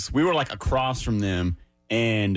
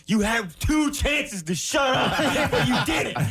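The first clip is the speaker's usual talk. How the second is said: much higher, slow, laughing through the line; laughing mock scold; 13 semitones higher, slower than usual. Boyfriend scolding his date on the ride home: laughing mock scold. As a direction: scold her while cracking up. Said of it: "her boyfriend's gonna be talking to her".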